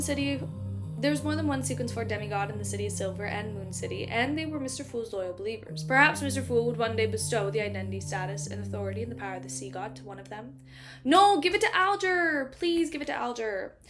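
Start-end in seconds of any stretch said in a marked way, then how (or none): none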